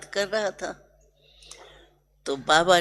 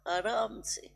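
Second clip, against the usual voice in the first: whisper; 3 semitones higher, 9 dB quieter than usual. Real, not acted. Describing Baba sitting relaxed.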